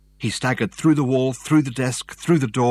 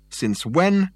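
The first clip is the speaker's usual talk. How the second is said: sharply higher, scornful "Since when?"; derisive question; 9 semitones higher, 4 dB louder than usual. A narrator's reading. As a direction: fling "Since when?" back with contempt. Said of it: "in a voice ringing with derision".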